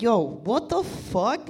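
high, drawn-out disbelief; baffled outcry. Retold or imitated. Man on the couch seeing intruders, baffled outcry.

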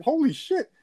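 high, hushed gasp of disbelief; mock stunned holy shit. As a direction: squeak it out in shock. Stunned reaction in retold scene.

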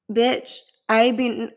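ordinary talk

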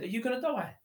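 put-on high child's voice asking; little son's voice. Seven-year-old son asking his dad, in a high child voice. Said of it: "He said".

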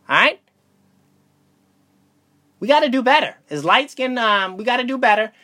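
rally them like a mock sermon; mock plea. Comic appeal to light-skinned men.

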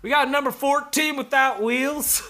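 high, sing-song order call; coworker calling orders. Retold or imitated. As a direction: call the order out like a sandwich shop worker. Brennan calling orders at work.

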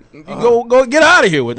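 high-pitched imitation of shooing a dog; mock scolding. Owner retelling how the dog was banished, mock scolding.

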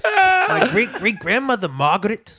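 high, loud put-on Scottish; mock Scottish accent. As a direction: ham up a Scottish brogue way up high. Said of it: "almost, like, a Scottish accent".

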